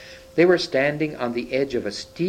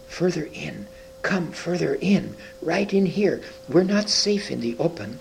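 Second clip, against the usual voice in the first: hoarse throaty whisper; 6 semitones higher than usual. A narrator's reading. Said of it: "in a hoarse, throaty whisper".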